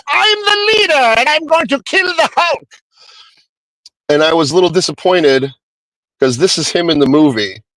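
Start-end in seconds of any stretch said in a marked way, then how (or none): 0.1-2.7 much higher put-on cartoon villain voice, boastful; cartoon Leader. Vlogger imitating the cartoon Leader's boast, high hammed-up villain voice. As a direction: ham up a high-pitched cartoon supervillain threatening the Hulk. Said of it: "he talks like this".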